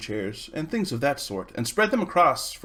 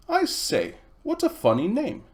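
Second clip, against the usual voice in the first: put-on a touch higher, teasing girl's voice; lightly voiced girl Polly; 10 semitones higher than usual. Girl teasing new boy about his name, lightly voiced. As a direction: tease him about his odd name, girlishly.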